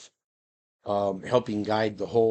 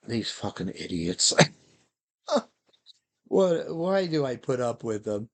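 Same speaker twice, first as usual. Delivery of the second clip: dog's inner voice. Acted. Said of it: "the thought balloon is like".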